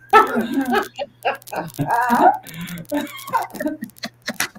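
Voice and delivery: breathless crying laugh; helpless laughter; wheezing laugh. Real, not acted. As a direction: laugh till you wheeze. Laughing at the rap.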